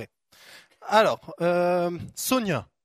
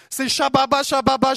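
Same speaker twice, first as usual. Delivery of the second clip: lightly mocking babble, a bit louder; mock tongues; 7 semitones higher, 6 dB louder than usual. Preacher mocking empty tongues, light babbling chant. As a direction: toss off the nonsense like a fake tongues prayer. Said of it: "C'est pas juste le Saint-Esprit".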